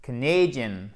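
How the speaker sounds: Canadian with stress moved to the second syllable; weak first syllable in Canadian, strong diphthong A in the second. Shown as a demonstration. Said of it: "la deuxième syllabe".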